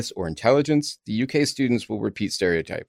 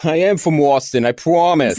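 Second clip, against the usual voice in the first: louder, higher hammed-up New Jersey accent; mock New Jersey accent; 6 semitones higher, 5 dB louder than usual. Host teasing co-host about hometown claim, mock New Jersey accent. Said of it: "out very New Jersey".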